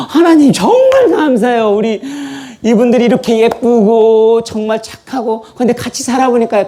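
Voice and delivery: gushing thanks. Retold or imitated. Adam gushing to God about Eve, high excited thanks. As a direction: overflow with gratitude.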